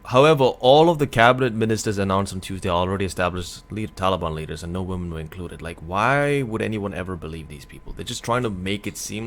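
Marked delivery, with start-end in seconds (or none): 0.1-5.6 louder, formal news-read voice; reading the headline aloud; news article read. Acted. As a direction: read it out like a report.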